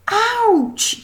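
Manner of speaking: high, sudden ouch; girl yelping ouch; yelp of pain. A narrator's reading. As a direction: yelp as your foot is stepped on. Gemma's foot stepped on.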